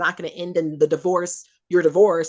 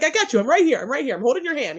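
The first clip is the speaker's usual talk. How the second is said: higher, tender comforting; reassuring Source; 9 semitones higher than usual. Retold or imitated. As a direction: Source holding the hand of someone in trouble, tender. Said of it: "so she's like".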